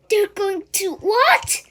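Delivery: high, loud horrified shriek; shocked scream. A narrator's reading. Wilbur hearing he'll be killed, screaming in shock. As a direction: scream it in disbelief. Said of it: "screamed Wilbur".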